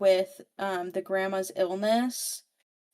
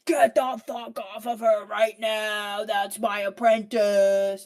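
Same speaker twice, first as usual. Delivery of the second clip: slow, put-on gruff warning; mock furious mentor voice; stern mentor; 3 dB louder, slower than usual. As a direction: lay down the law to the brothers. Tristan storms in, furious.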